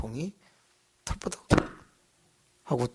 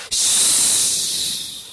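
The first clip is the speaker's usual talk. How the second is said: ghostly hiss; 4 dB louder than usual. Retold or imitated. Figure appears from the cross.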